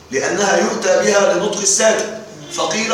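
ordinary talk

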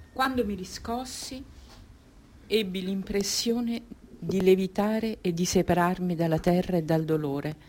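very soft, detached; dazed recollection. Acted. Woman recalling coming round after the pain, dazed. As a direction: recall it quietly, as if floating away from pain.

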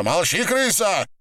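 high-pitched angry order; angry command. Acted. Captor silences pleading woman, high angry command.

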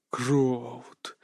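low hissed name; hissing feral vampire. Acted. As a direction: hiss the name through the teeth. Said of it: "прошипел вампир".

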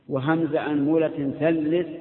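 ordinary talk, nothing marked

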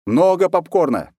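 loud call across the room. Acted. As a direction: shout it after her. Calling to cook across yard.

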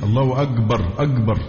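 أكبر with a hard g in place of k, "agbar"; Egyptian gim. Shown as a demonstration. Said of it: "الجيم المصرية". ("أكبر" بالجيم المصرية)

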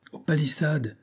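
high, restrained call to action; cried order. A narrator's reading. Supplier sending men to the fence, understated cry. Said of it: "s'écria Mathias Van Guit".

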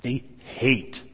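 hate held long for emphasis; stress on hate. Real, not acted. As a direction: set hate apart and stretch it out. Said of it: "put enough emphasis on the word hate".

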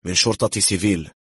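usual speaking voice

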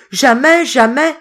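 high, loud cry of horror; terrified cry. A narrator's reading. Poor boy refusing to kill his cat, terrified cry. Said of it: "s'écria le pauvre garçon ... d'épouvante".

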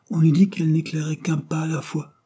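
voix haute, perdue dans ses pensées; absorbé. A narrator's reading. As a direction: poursuivre sans répondre, tout à son rêve. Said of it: "évidemment absorbé par ses propres pensées". (ton rêveur et absorbé, haut placé)

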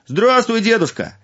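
high, loud cheerful fool; simple young fool. Acted. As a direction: greet grandpa brightly. Fool greets old man.

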